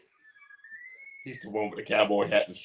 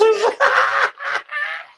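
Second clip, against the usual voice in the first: high, loud burst of laughter; loud belly laugh; 8 dB louder than usual. Real, not acted. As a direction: crack up helplessly at a joke. Laughing at co-host's joke.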